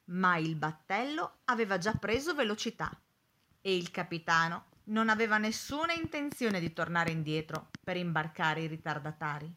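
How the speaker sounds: ordinary delivery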